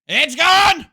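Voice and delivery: very high, full-force scream; over-the-top high victory scream; screaming triumph. Pretend. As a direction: shriek it at the top of your voice. Repeats the shout at full pitch.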